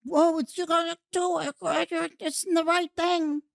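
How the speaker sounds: high, hammed-up preachy tone; mocking voice. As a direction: parody a preachy do-gooder. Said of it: "incentivize it beyond".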